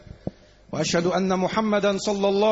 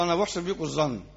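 ordinary talk, nothing marked

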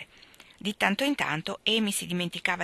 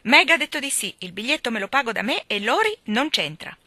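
loud, defiant, punching "io"; more provoking than ever; 5 semitones higher, 8 dB louder than usual. Acted. Amy defiantly provoking her older sisters. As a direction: taunt them, defiant brat. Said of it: "più irritante che mai".

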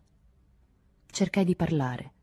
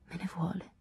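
a soft inward murmur, much quieter; hushed thought; 3 semitones higher, 9 dB quieter than usual. A narrator's reading. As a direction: think it to yourself, hushed. (pensiero sussurrato sottovoce)

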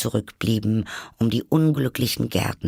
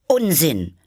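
raised, higher, decisive voice; loud and firm; 7 semitones higher, 4 dB louder than usual. A narrator's reading. Alice defying the raging Queen, loud and firm. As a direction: cut in firmly, pitched up. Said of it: "sagte Alice sehr laut und bestimmt".

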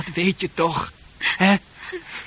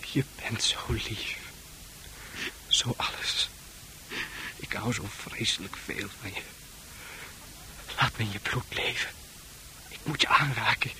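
whisper; 2 semitones lower, 6 dB quieter than usual. Acted. Arn whispering to Fran, obsessively. Arn's intimate menacing whisper.

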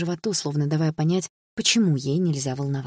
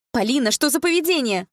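high, raised-voice reprimand; mother shouting; 9 semitones higher, 4 dB louder than usual. A narrator's reading. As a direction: cry out in outrage at your daughter. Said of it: "закричала мама".

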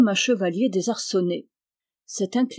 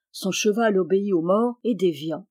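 ordinary delivery, nothing marked

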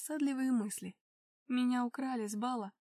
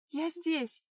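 higher-pitched answering call; 5 semitones higher, 3 dB louder than usual. A narrator's reading. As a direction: call back so they can find you. Calling back to searching husband.

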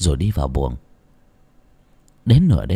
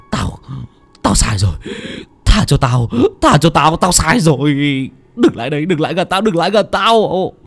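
panicked pleading; 4 semitones higher than usual. Acted. Old man begging the ghosts to spare him, panicked. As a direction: beg for your life, climbing in panic. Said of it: "Lão nhân hốt hoảng".

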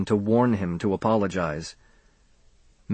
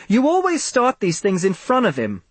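higher-pitched marital accusation; accusing; 10 semitones higher than usual. Acted. Parents quarrel over upsetting their son, accusing. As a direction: throw the blame back at a spouse.

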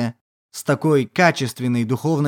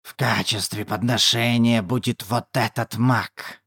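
low witch voice; 6 semitones lower, 5 dB quieter than usual. Acted. Witch points at Leylin.

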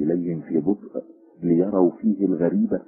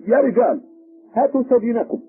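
loud, high-pitched order; calling out; 6 dB louder than usual. A narrator's reading. Policeman calling his men to bring prisoner, high loud call. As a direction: shout back to the men.

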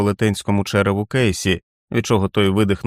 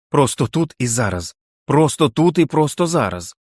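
rising to a high strained yell; high shouted demand; insistent yell; 5 semitones higher than usual. Acted. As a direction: demand it, voice climbing. Jack yelling at the wolf.

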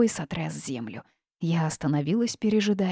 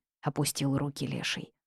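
ordinary delivery, nothing marked

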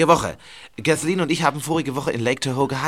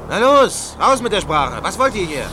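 angry demand; 5 semitones higher than usual. Acted. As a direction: bark it at the intruders. Man with gun confronts intruders.

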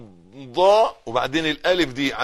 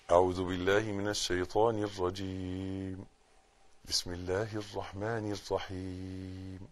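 quiet recitation; 6 semitones lower, 13 dB quieter than usual. Shown as a demonstration. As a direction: recite gently, drawing out the vowels. Recites Fatiha slowly.

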